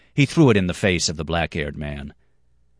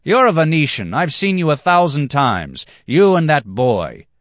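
angry accusation; 9 semitones higher, 7 dB louder than usual. Acted. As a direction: point the finger hard.

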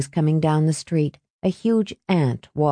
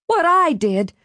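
high incredulous echo, a different voice; outraged disbelief; 11 semitones higher than usual. Acted. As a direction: throw his words back at him in shock. Mother outraged at son.

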